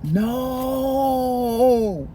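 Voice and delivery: long, held-out wail of disbelief; dismayed no. Retold or imitated. Storyteller opens the door to Sean, long dismayed no. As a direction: stretch the word out in acted dismay.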